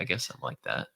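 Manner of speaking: put-on low, quiet shrug of a teen; understated bored-teen resignation; bored, indifferent teenager. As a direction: lightly play an apathetic teenager. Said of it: "like, indifferent and bored teenagers".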